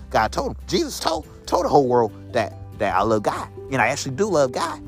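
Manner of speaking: usual speaking voice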